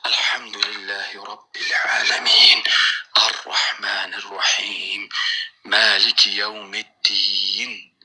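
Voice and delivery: slow, hushed, drawn-out chant; slow recitation. Shown as a demonstration. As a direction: recite slowly, barely audible to yourself. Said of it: "خودت بشنوی". (تلاوت آهسته و آرام)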